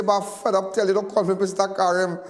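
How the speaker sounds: imitating a friend's playful protest; mock protest. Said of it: "he say".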